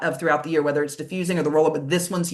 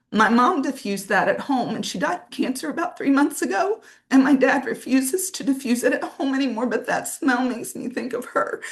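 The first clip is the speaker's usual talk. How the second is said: tearful, higher-pitched retelling; real crying; 6 semitones higher than usual. Real, not acted. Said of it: "That's why I'm crying".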